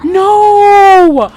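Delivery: loud high anguished no; shouted no. Real, not acted. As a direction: yell it out in despair. Sim death shock.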